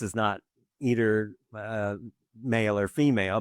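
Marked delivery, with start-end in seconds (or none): none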